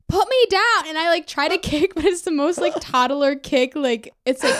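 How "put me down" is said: high, loud panicked plea; panicked yelling. Retold or imitated. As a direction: yell it kicking and helpless. Retold panic while carried.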